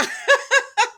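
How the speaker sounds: a sudden high laugh, loud; high burst of laughter. Real, not acted. Cracking up at her own soap commentary.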